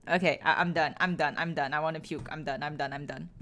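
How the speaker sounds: low, quiet repeated surrender; defeated, soft and low, over and over. Real, not acted. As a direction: give up under your breath, fading out. Giving up, feeling like puking.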